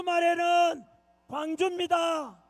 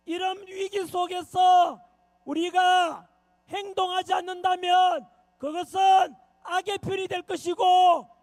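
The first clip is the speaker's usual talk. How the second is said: usual speaking voice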